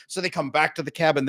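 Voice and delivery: normal talking voice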